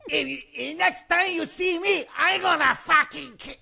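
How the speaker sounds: high-pitched imitation of a raging man; foamingly pissed. Enraged stranger threatens him in casino, foamingly pissed. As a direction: rant like a livid little guy, cut short. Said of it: "foamingly pissed".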